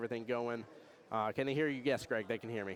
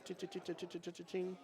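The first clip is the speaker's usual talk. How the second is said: ticket machine noise; 7 semitones higher, 6 dB quieter than usual. Retold or imitated.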